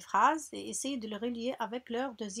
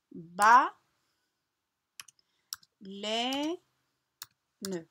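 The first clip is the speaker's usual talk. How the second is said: slow, separate syllables with long pauses between; 5 dB louder, much slower than usual. Shown as a demonstration. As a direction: sound the word out for young pupils, "ba" punched loud. Teacher sounds out word by syllables.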